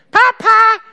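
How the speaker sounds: put-on high, loud crowing child's Papa; kid's high loud call across the room. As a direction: crow it out like a shrill little boy. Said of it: "kräht der so, hat so eine hohe Stimme".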